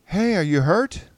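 high, loud shouted question; calling out. A narrator's reading. Calling to a stranger who may be hurt, shouting. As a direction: shout over to someone out of sight. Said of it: "I called out".

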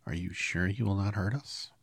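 a touch of timid little queen. A narrator's reading. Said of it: "asked in a timid voice".

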